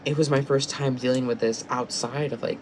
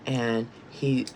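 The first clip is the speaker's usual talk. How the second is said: usual speaking voice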